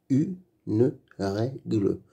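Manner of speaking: Une règle slowly split into u-ne-rè-gle; slow beginner's decoding of "Une règle", final e voiced; syllable by syllable. Shown as a demonstration. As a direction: sound out "Une règle" with gaps between syllables. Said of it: "Lis les mots".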